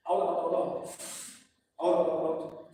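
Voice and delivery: sharp, loud puffs of breath on each out; forced quick exhales. Shown as a demonstration. Trainer demonstrating bad breathing to students, rapid forced puffs. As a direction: show the wrong way, pushing air out fast. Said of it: "You cannot, like".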